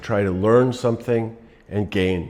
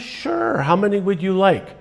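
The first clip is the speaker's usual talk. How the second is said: much higher, silky host offering anything; smooth casino host; 10 semitones higher than usual. Retold or imitated. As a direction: tempt him like an eager host.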